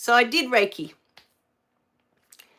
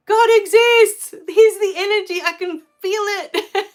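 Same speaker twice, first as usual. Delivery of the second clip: ecstatic revelation; 10 semitones higher, 9 dB louder than usual. Retold or imitated. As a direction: proclaim it like a sudden revelation. Reliving her moment of revelation.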